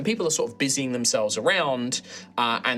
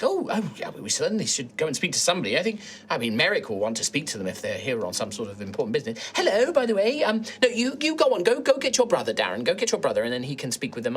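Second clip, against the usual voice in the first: put-on bustling old lady taking charge; fussy old halfling woman; faster than usual. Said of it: "she's like".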